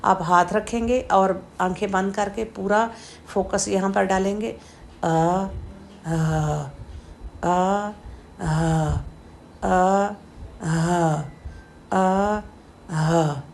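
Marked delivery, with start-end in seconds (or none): 5.0-13.4 आ and हा drilled slowly from low in the throat; practice deep throat sounds. Shown as a demonstration. Teacher drilling students on deep throat letters. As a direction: repeat आ, हा, hand on chest. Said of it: "ऐसा इसको practice करेंगे".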